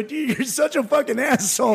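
high, drawn-out mock reproach; amused scolding. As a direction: scold a friend while tickled by the prank. Retold amused scolding of his friend.

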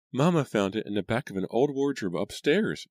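put-on high little girl voice; girl's voice. A narrator's reading. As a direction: lift the voice up into a young girl.

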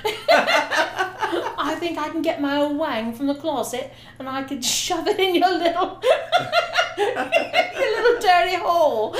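put-on British accent, cracking up mid line. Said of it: "give us your best British accent".

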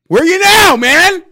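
rising into a furious shout at the absent player; angry yelling. Real, not acted. Fan yelling at a player who vanished, furious. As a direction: yell it like you want to find him.

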